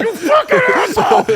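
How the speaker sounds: imitated coach scream. Rick Pitino screaming at his player, hammed-up furious yell. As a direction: roar it nose to nose like a furious coach. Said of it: "Ray was like".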